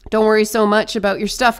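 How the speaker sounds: usual speaking voice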